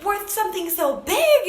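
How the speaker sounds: high, elated, laughing outburst; exhilarated. Retold or imitated. Woman calls her coach about her huge worth, elated and high-pitched. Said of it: "like, exhilarating".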